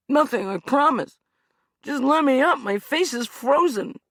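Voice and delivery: high, loud pleading; desperate plea. Acted. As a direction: beg to be let up. Hugh begging release.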